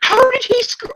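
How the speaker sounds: how could he even, outraged; incredulous outburst. Real, not acted. Co-host baffled by Peter's line, incredulous high outburst. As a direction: pitch jumps way up in disbelief.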